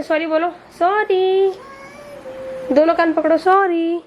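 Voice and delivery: high, slow sorry for the bird to copy; drawn-out sorry. Shown as a demonstration. To the parrot, a high, drawn-out sorry to repeat. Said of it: "तो इसने बोलना सीखा है sorry".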